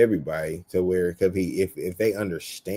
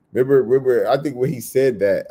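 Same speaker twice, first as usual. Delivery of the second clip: usual speaking voice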